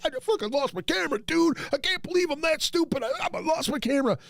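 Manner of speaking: high, fast, panicked wail; near tears. Retold or imitated. Panicking to Al about the lost camera, high and near tears. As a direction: rush it out, voice way up, on the edge of tears. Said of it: "almost crying".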